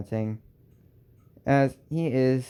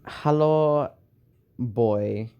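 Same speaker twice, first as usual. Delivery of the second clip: Bismarck greeting; much slower than usual. Acted. Bismarck greeting the fallen boy, in a put-on voice. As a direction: greet him as stern Bismarck. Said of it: "I know German".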